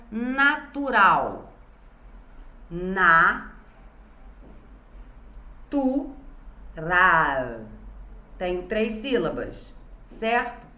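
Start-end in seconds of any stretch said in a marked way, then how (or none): 2.7-7.6 "Na, tu, ra" with long pauses between syllables; part by part. Shown as a demonstration. Teacher showing how to separate syllables, "Na, tu, ra" part by part. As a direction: sound out "Na, tu, ra" one syllable at a time. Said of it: "fala parte por parte da palavra".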